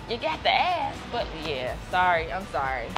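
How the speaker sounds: normal talking voice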